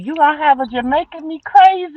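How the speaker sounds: put-on high, loud, gleeful kid's voice; excited child quote. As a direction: squeal it out like an excited grandchild. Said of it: "And me, and Nene".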